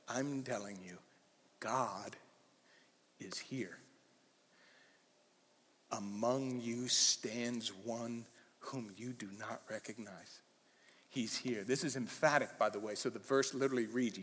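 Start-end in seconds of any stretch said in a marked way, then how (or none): 0.1-11.6 soft, slow and grave, almost breathed; hushed intense declaration. Retold or imitated. Preacher voicing John telling the delegation God is here, hushed. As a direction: let it sink in like a secret revealed. John the Baptist proclaims God's presence.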